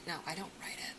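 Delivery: ordinary talk, nothing marked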